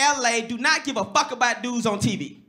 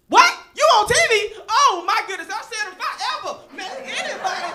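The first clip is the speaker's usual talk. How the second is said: put-on starstruck girl; 8 semitones higher than usual. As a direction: gush like meeting a celebrity. Excited Florida girl.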